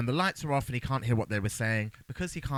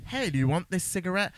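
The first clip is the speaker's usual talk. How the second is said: lightly acted higher-pitched offer; acted casual offer; 8 semitones higher, 4 dB louder than usual. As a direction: pipe up with a mock offer. Voicing Lawrence offering a cigarette.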